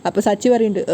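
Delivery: ordinary delivery, nothing marked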